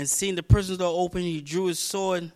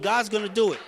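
ordinary delivery

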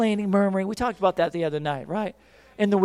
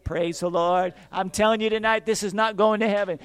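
ordinary talk, nothing marked

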